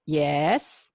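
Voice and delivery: "yes" dipping low then rising; drawn-out doubtful "yes"; skeptical third tone. Shown as a demonstration. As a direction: answer a drawn "yes" that sinks and lifts, unsure who is calling. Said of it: "You are a little bit skeptical".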